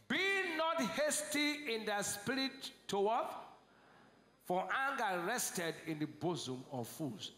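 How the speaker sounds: drawn-out, lifted verse reading; slow scripture reading. Acted. Preacher leading the church through the verse slowly. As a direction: stretch each word of the verse. Congregation reads verse together.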